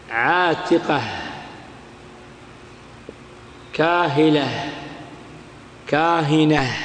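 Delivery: "عاتقة، كاهلة، كاهنة" each ending in soft h; pause on ha. Shown as a demonstration. Teacher listing examples, final ta said as h. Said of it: "وقفت بالهاء".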